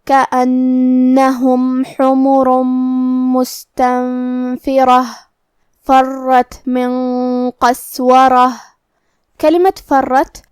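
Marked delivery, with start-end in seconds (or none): 0.0-8.5 slow, drawn-out tajweed chanting; Quranic recitation. Shown as a demonstration. Teacher reciting the verse for students, slow melodic chant. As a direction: intone each verse slowly and melodically.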